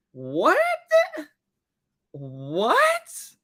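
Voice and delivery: incredulous "what", rising sharply; acted disbelief. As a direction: turn round in mock disbelief. Acting out her withheld disbelief.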